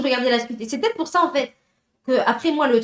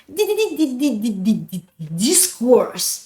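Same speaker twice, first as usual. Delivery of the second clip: long run of stutters into a drawn-out name; longer jingle version; much slower than usual. Shown as a demonstration. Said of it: "on peut faire une, une version". (version longue du jingle, bégayée)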